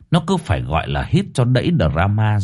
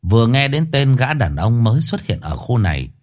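ordinary delivery

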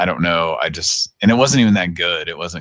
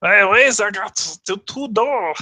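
high, slow, badly faked German accent; hammed-up German accent attempt; mock German accent; 14 semitones higher, slower than usual. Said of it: "I can't do a German thing".

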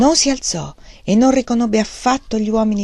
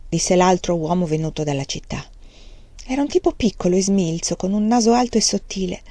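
plain reading voice

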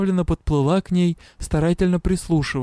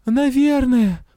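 plain reading voice

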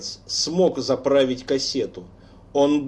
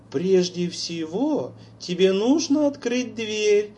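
put-on eerie girl's voice; 6 semitones higher than usual. A narrator's reading. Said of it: "звучал голос Лоры".

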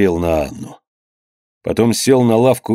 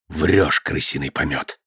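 low, quieter contempt; contemptuous accusation; 6 semitones lower, 6 dB quieter, slower than usual. A narrator's reading. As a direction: call out the lie with scorn. Father accuses son of lying. (презрительно, низко и негромко)

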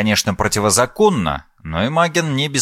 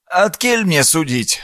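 lightly voiced creaky old man; slow, higher old peasant shrug; 7 semitones higher than usual.

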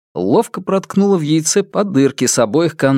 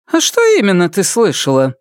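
defiant challenge; 7 semitones higher than usual. A narrator's reading. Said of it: "с вызовом поинтересовалась".

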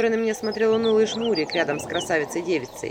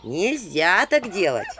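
mock scolding; 4 semitones higher, 4 dB louder, slower than usual. A narrator's reading. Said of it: "Грозила я пальчиком".

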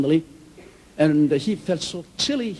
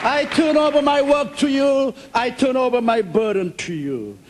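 fervent prayer; 7 semitones higher than usual. Acted. Praying aloud to God.

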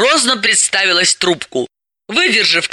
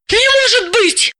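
much higher, astonished cry. Acted. Dalila hears about the pistol, astonished. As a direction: unable to believe it. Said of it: "поразилась Далила".

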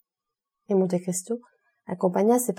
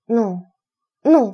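an anxious steward's louder cry of refusal; 2 semitones higher, 7 dB louder than usual. A narrator's reading. Bertuccio refuses his master, crying out. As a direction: push the two nos out in alarm. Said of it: "s'écria Bertuccio".

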